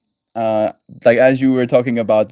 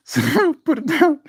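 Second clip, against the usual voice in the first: helpless laughter, much higher pitch; laughing. Real, not acted. Streamer begs to end the stream, real helpless laughing. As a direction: squeeze the words out through laughter.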